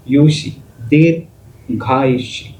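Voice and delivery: युशी and दीर्घायुष्य said very slowly, each syllable drawn out; careful pronunciation. Shown as a demonstration. Teacher models the word for students, slow and careful. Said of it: "यूचा उच्चार तुम्ही उच्चार करून बघा".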